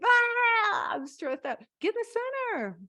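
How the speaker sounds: high, loud mock wail; stressed-out outburst. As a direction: cry out in hammed-up overwhelm. Acting someone stressed wanting the center.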